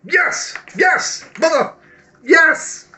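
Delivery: very high, loud celebratory yells; excited shouting. Real, not acted. Player celebrating a lucky dice result, real excited shouting. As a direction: burst out yelling in triumph. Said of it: "Sorry, I got a bit excited".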